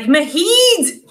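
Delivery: a quick hammed-up Scottish exclamation, pitched high; bursting out with the Scottish word as Jamie would say it; Scottish accent. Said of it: "Diana writes out how Jamie would say it".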